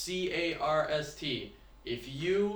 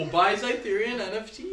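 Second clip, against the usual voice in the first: higher, louder playful pitch; 6 semitones higher, 5 dB louder than usual. Pretend. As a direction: sell it like a cheesy ad. Teasing ad voice.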